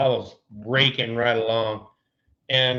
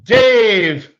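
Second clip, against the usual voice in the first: mock announcer intro; 4 semitones higher, 4 dB louder than usual. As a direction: present him like a ballpark announcer. Introduces pitching coach on card.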